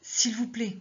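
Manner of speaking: a suppliant please; pleading. Acted. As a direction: beg him gently to try again. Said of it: "Un ton plus suppliant fonctionne mieux".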